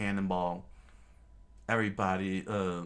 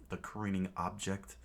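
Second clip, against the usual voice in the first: ordinary delivery, nothing marked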